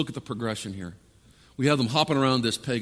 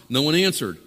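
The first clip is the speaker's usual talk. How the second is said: usual speaking voice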